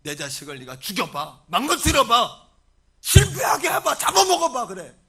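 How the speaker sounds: very loud, soaring challenge; defiant shouting. Acted. Missionary parent defying Satan over their child, defiant shouting. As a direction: hurl each declaration at the enemy. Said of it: "사단을 향해 외치는 거예요".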